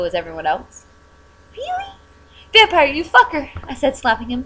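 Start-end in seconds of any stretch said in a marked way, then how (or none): 1.5-3.5 high, loud outraged cry; a squeal of outrage turning into a curse; furious. Acted. Slapping him, furious.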